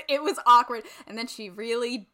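normal talking voice

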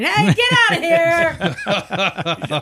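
high, slow, drawn-out pretend yell; mock yell. Teasing friend imitates the shop owner's greeting, a high mock yell. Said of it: "You acknowledge me by yelling".

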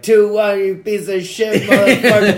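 high, loud laughing taunt; hammed-up heckling fan yell; mock angry heckling. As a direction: yell it like a gloating football fan. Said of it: "Man United dad is just talking shit".

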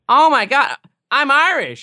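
high, loud gushing claim; hammed-up thrilled American; gushing American claiming Irish roots; mock excited American.

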